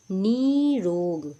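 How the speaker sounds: very slow नी रोग, the "nee" held long; exaggerated long ee in नी रोग; long-vowel demo. Shown as a demonstration. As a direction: stretch नी in नी रोग right out. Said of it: "दीर्घ में बदल दिया जाता है".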